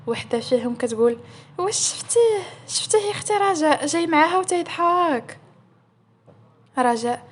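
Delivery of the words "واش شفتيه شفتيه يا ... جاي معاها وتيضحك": high-pitched, scandalised questions; astonished gossip. Acted. One employee gossiping to Raja about the boss, astonished. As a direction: gossip in high, drawn-in amazement.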